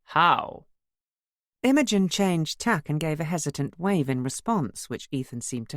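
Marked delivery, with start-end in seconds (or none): 0.0-0.7 mock Indian chief. A narrator's reading. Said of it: "pretentious Indian chief style".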